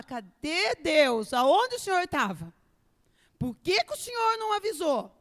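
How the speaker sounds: loud, high complaint to God; a believer shouting at God that he never warned; frustrated outcry. Retold or imitated. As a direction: demand where God was.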